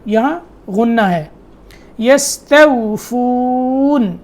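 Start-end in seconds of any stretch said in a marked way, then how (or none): 2.0-4.2 یستوفون slowly, with a thin light kaf. Shown as a demonstration. Teacher showing students the thin kaf, slow and careful. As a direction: draw out یستوفون, keeping the kaf fine. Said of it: "یہاں ایسے اس کو باریک کر کر پڑھنا ہے".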